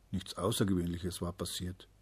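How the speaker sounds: ordinary delivery, nothing marked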